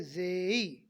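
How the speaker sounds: जे drawn out, gliding into ई; demonstrated J. Shown as a demonstration. Teacher showing students the letter J, long gliding जे. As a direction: stretch the vowel of जे. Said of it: "जे को बोलते हैं".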